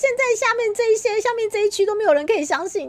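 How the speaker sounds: high, loud mock-crying wail, easing off toward the end; 崩溃哭喊. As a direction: wail at chat as if betrayed by everyone. Mock despair at lying viewers. (夸张地高声假哭抱怨)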